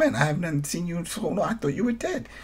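fast, high, gushing mock reunion; hammed-up joy at seeing a sibling alive; overjoyed reunion.

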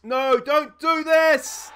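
begging the players to stop; yelling at screen. Real, not acted. Manager pleading at his team on screen: desperate yell. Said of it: "sitting here yelling at the screen".

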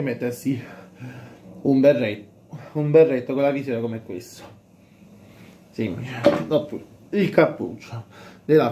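muffled by cloth over mouth; speaking through fabric mask; through a balaclava. Real, not acted.

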